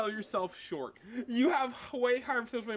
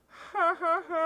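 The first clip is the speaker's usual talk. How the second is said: fake awkward laugh; 11 semitones higher, 6 dB louder, slower than usual. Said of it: "we're all like".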